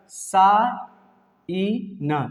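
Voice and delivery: सा ई न in separate pieces, pauses between; broken into sounds. Shown as a demonstration. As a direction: sound out सा ई न bit by bit without g. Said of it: "साइन पढ़ेंगे". (सा ई न, अलग-अलग करके)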